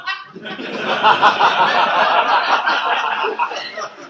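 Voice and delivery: rising burst of laughter; loud laugh. Real, not acted. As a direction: let out a big laugh. Crowd joke.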